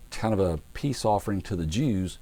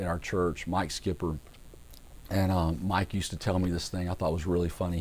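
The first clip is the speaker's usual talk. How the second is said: ordinary delivery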